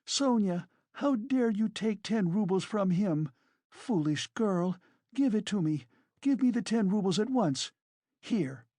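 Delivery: high-pitched scolding woman; agitated, imperious character voice; indignant Katerina. A narrator's reading. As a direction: scold her and demand it at once.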